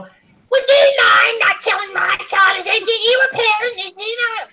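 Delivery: high, shouting whiny parent voice; mocking offended parent. Parent scolding a stranger for telling off their child, high mocking shout. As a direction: sneer it like a prissy indignant parent. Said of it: "you get these parents going".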